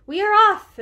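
high, loud and excited Mouse; happy exclamation. A narrator's reading. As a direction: exclaim it with eager delight. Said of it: "exclaimed Mouse with a happy wag".

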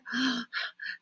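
out of breath from running; breathless gasp. A narrator's reading. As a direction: pant for air after the run. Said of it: "Out of breath".

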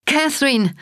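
Catherine called out high; mother calling for her daughter. A narrator's reading. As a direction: cry out the name across the room. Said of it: "rief meine Mutter".

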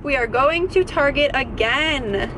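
usual speaking voice

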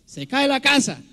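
high-pitched imitation of a bird's cry; high-pitched female bird. Lecturer mimicking the female guacharaca's call, high-pitched. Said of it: "la hembra lo dice en un tono agudo".

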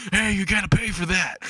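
high-pitched mall cop calling after a thief. Acted. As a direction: call it out as a put-on cop. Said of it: "a fat mall cop starts chasing him".

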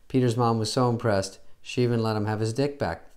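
ordinary talk, nothing marked

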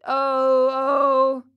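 loud, sustained storybook wail; acted wailing cry. A narrator's reading. Hungry Pinocchio crying to be heard for miles, loud wail. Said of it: "began to scream and cry so loudly".

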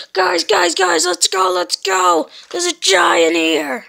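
loud frantic call to run; rally everyone to flee; urgent shouting. Acted. Calling everyone to flee giant.

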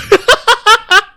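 loud, high staccato put-on laugh; high loud cackle. Game character laughs at a question, high loud cackle.

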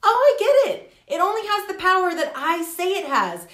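high, loud burst of understanding; sudden realization. Retold or imitated. Acting out her sudden realization.

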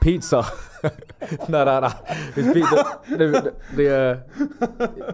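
giggling through the words, voice jumping up; cracking up mid-sentence; laughing. Real, not acted. Laughing at the pizza answer, giggling hard.

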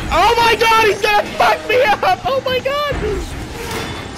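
high, loud panicked shrieking; being chased. Real, not acted. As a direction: shriek as it grabs at you. Monster closing in on player.

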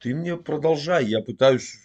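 ordinary talk

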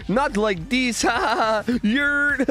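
high-pitched hyped streamer impression; impression of Otofu. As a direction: cackle and yell yurt like a hyped streamer. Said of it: "Can you do an impression of famous Twitch streamer".

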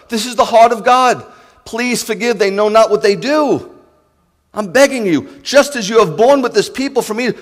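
loud, rising fervent preaching; impassioned pulpit cry; preaching at full force. Acted. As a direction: thunder it out to the congregation. Pleading preaching, raised.

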